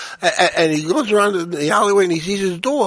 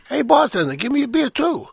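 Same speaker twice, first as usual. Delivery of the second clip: voiced talking dog; 7 semitones higher than usual.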